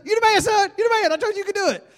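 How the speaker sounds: high, loud excited hollering; cheering dad. Acted. Dad cheering his son round the ride, exuberant. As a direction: whoop it out to a kid riding past.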